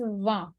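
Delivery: "va" with final consonants silent; French "va", no G or T sounded; correct French pronunciation. Shown as a demonstration. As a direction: say "va" as one open nasal syllable. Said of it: "But the pronunciation is va".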